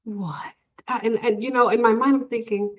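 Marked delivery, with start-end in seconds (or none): 0.0-0.5 whispered, retold disbelief; stunned whisper. Assistant thrown off by patient's words, stunned whisper. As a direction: barely breathe it, thrown off.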